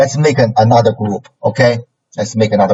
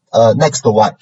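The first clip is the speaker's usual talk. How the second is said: normal talking voice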